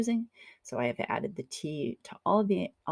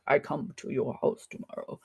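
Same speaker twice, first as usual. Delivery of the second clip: lower, lightly voiced caveman; caveman talk; 3 semitones lower than usual. Shown as a demonstration. Teacher showing how German phrasing sounds in English, mild caveman talk. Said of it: "It's like caveman talk".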